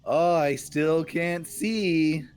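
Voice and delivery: drawn-out, slow complaint; slow whiny retort. Acted. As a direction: drag each word like a blinded guard. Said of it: "the guard retort with".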